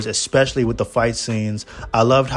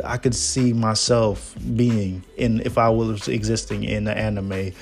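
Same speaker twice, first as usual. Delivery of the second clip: ordinary talk, nothing marked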